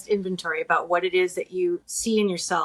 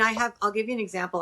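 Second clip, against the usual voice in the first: normal talking voice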